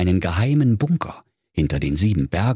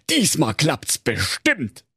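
high, put-on voice with a laugh; a giddy king, sure of success; 7 semitones higher than usual. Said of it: "sprach König Fugenkäse".

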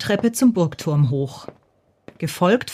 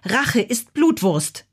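slow, higher-pitched threat; measured mock-menacing call; calling after someone; 5 semitones higher, slower than usual. A narrator's reading. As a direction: vow revenge slowly, drawing the words out. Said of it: "rief Bacilla ihm nach".

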